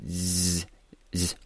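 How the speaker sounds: "z" as a long buzzing friction sound; English z. Shown as a demonstration. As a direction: hold a voiced, buzzing "z" without a vowel. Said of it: "读英文中的这个z". (读英文浊辅音 z，摩擦拉长)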